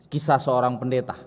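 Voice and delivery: ordinary talk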